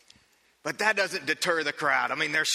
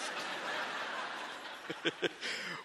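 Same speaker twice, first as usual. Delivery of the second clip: breathy laugh; 8 dB quieter than usual. Real, not acted. Laugh after pitcher line.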